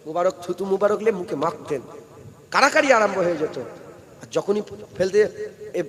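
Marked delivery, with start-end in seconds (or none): none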